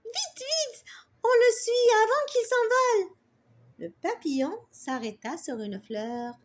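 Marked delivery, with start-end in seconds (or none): 0.0-3.2 put-on high, excited child urging her sister on; eager hurry. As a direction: rush after the butterfly, thrilled. Sarah pressée de suivre le papillon. (fillette pressée et excitée, voix haute)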